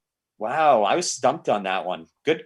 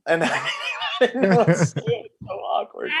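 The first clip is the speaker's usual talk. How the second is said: laughing; 7 semitones higher than usual. Real, not acted. Laughing at his own awkwardness.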